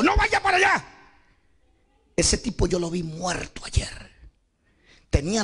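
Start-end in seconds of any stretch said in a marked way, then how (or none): 0.0-0.8 high, urgent call of warning; sudden high-pitched holler; frightened warning shout. Acted. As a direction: act out a scared boy yelling. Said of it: "él me dijo".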